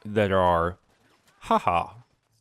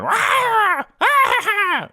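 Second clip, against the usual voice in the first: high, loud cackling guffaw; gremlin's belly laugh; 8 dB louder, much slower than usual. Retold or imitated. The gremlin loving a schooner joke, loud high cackle. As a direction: cackle like a delighted little gremlin. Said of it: "That little gremlin".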